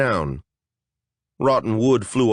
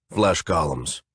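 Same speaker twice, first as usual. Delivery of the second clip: a lower, grim warrior voice; name the threat flatly; gruff warrior; 5 semitones lower than usual. Acted. Justicar naming monsters, low.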